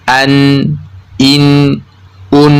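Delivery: an, in, un with vocal cords open; open hamzah syllables. Shown as a demonstration. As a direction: say an, in, un one by one from an open glottis. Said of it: "posisi pita suara dalam keadaan terbuka".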